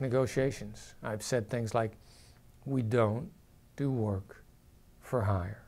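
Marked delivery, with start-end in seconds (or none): 2.7-5.6 firm, falling, no room to argue; settled falling tone; downward inflection. Shown as a demonstration. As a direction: pitch sinking lower on each pause-split phrase. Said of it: "Just like that".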